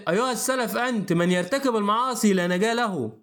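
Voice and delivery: ordinary delivery, nothing marked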